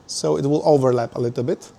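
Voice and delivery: usual speaking voice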